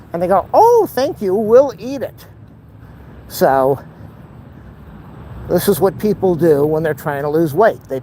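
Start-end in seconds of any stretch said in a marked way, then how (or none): 0.5-2.3 1 neighbors' high-voiced thanks. Neighbors accept the ice cream.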